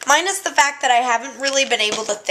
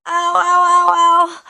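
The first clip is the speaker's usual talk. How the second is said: sharp pained ow, ow; foot cramp pain; 5 semitones higher, 6 dB louder, slower than usual. Real, not acted. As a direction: yelp through a cramp. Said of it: "I am having a foot cramp right now".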